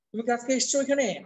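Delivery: ordinary talk, nothing marked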